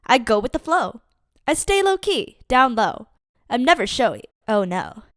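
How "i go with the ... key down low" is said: ordinary delivery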